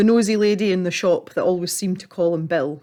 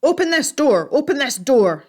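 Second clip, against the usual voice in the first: high, louder pounding shout; 8 semitones higher, 6 dB louder than usual. Acted. Angry old man hammers on the neighbour's door, shouting. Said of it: "he shouted".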